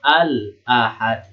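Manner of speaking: slow "Al-Ahad", the l held and fully sounded; clearly read lam. Shown as a demonstration. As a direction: recite "Al-Ahad" with every letter distinct.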